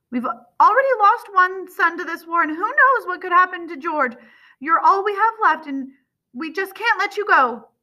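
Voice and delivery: high, loud maternal anguish; overwrought, insistent plea; distraught pleading. A narrator's reading. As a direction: plead desperately, pitch held high. Grieving mother pleads with son.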